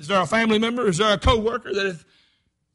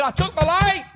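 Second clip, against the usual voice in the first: high, loud protest; insistent defence; 10 semitones higher, 7 dB louder than usual. Acted. Watchman defending himself on trial, insistent protest. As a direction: insist on it to the court.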